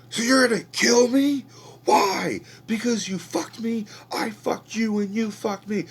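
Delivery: high, loud furious yelling; screaming rant. Retold or imitated. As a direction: scream it at them, outraged. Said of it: "starts screaming at them".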